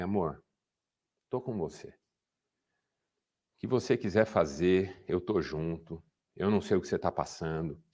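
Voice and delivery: husband soothing his crying wife, tender and soft; tender comfort. Retold or imitated. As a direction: hold her and speak softly.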